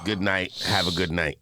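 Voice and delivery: low, easygoing sign-off; calm goodnight. Acted. Suggesting how a cousin could say goodnight, calm and low.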